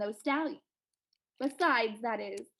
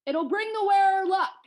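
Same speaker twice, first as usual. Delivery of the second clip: high, loud giant's voice; coaxing giant; 8 semitones higher, 7 dB louder than usual. Acted. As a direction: call out a tempting promise. Giant coaxing the escaping man.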